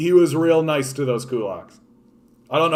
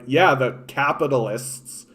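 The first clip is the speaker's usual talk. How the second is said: normal talking voice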